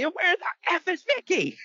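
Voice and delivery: high, slow mock yell. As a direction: stretch it out in a high shriek. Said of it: "my favorite part".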